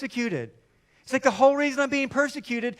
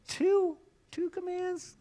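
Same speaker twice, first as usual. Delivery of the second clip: much higher, pleading question; mock bargaining; 9 semitones higher, 3 dB quieter, slower than usual. Preacher as skeptic bargaining with Paul, much higher pitch. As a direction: haggle like a child.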